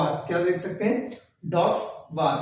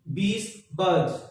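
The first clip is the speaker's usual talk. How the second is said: बीज बज stretched out, very slow and clear; slow pronunciation drill; much slower than usual. Shown as a demonstration. Teacher drilling बीज बज for students, very slow. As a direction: draw out बीज बज so students can copy. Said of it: "यानी कि इसका pronounce क्या होगा?".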